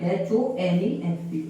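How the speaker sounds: H O N E S T letter by letter, slow; spelling out. Shown as a demonstration. Teacher spelling the word for students, slowly.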